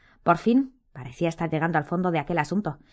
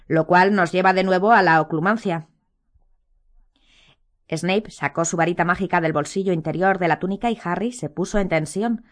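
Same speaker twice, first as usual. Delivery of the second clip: plain reading voice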